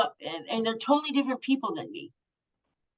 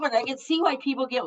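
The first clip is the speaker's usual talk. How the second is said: usual speaking voice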